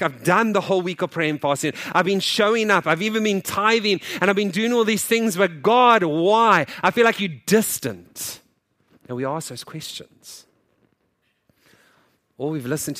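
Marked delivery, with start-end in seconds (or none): 0.0-8.4 loud, high frustrated plea; frustrated complaint. Pretend. Believers complaining to God, loud and frustrated. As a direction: vent to God, rising to a cry.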